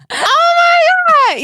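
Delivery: very high, slow, loud wail of "Oh my God"; drawn-out Oh my God. Retold or imitated. Teresa reacting slowly, high loud wail. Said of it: "you gotta get the pause".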